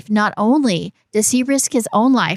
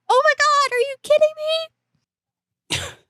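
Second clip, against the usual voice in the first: much higher, shocked squeal; mock disbelief; much faster than usual. Mocking her friend's opinion in a high shocked squeal. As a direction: shriek it like you can't believe it. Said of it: "I'm like".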